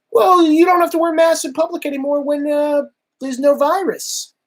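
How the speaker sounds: a sneering imitation of the official; mocking Fauci. Mocking Fauci's mask advice to the viewers, high and put-on. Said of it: "Fauci who are saying things like".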